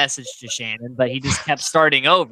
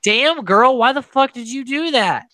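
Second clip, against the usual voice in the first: raised, exasperated disbelief; mock-scold her for skipping the honeymoon; incredulous scolding; 6 semitones higher than usual. Podcaster scolding Nicole for leaving her honeymoon, exasperated. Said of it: "And I'm like".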